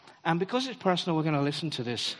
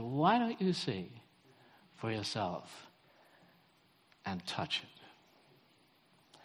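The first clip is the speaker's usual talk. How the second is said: soft, fading to a whisper; gentle invitation; 5 semitones lower, 6 dB quieter, much slower than usual. Retold or imitated. Obama gently inviting the shy boy, fading to a whisper. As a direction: lower and softer, breathing out the last words.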